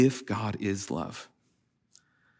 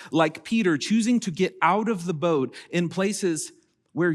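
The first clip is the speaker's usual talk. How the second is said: higher, louder preaching voice building with conviction; impassioned preaching; 7 semitones higher, 4 dB louder than usual. Acted. Pastor urging the congregation, impassioned preaching voice. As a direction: lift the room as you preach.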